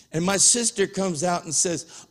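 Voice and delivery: usual speaking voice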